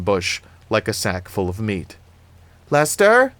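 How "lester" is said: loud, high searching call; calling out into the night. A narrator's reading. Annie calls into the night for the missing dog, calling loudly. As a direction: call the name out loudly, pitched well up. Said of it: "Annie's voice filled the night".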